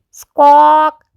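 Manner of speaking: loud high bird screech; parrot squawk. A narrator's reading. Parrot about to speak, loud high squawk. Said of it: "Polly Parrot opened her beak".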